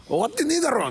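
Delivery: high, comic indignant shout; hammed-up protest; mock outraged retort. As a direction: snap back like the show isn't over. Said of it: "みたいな感じで言おうとした".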